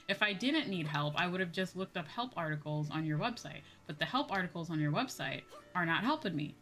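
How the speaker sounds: ordinary talk, nothing marked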